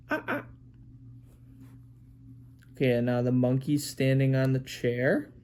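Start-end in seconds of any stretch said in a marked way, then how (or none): 0.1-0.5 high-pitched monkey "ah ah"; monkey noises. Acted. Parent showing the monkey in a picture book, monkey noises. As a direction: squeal two short monkey calls. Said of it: "And then you have the monkey".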